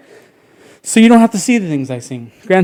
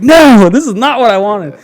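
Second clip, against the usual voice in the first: louder, higher whiny protest; dismayed kid; 7 semitones higher, 10 dB louder than usual. Retold or imitated. Boy sees his mom losing her mind, loud whine. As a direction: wail it like a kid whose plan failed.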